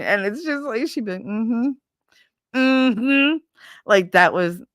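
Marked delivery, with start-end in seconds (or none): 1.2-3.4 high, rising wordless hum; knowing mm-hmm. Retold or imitated. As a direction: imitate grandma's wordless acknowledgement. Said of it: "grandma was the queen of the nonverbals".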